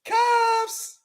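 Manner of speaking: very high sung-out cuffs; high squeal cuffs. Acted. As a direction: call it out in a high falsetto. Sound-effect call.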